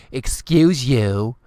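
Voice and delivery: slow, haughty rising "Excuse you"; mock a stuck-up girl's put-down, drawn out; drawn-out snooty rebuke with a rising end; snotty "excuse you". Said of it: "they'll come up to you and go".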